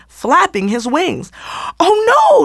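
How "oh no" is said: high, loud cry of alarm. A narrator's reading. Naomi cries out as Fancy runs toward the gathering. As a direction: call out in sudden panic. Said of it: "Naomi called out".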